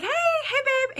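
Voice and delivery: high cheerful mock greeting; super happy husband. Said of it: "super happy".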